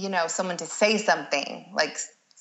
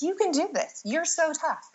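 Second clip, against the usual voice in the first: much higher, sugary cheering-on voice; sweet encouragement; 10 semitones higher than usual. Retold or imitated. Doula to her client on the stairs, a high sweet cheer. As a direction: coax them along like a cheerleader. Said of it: "but I was like".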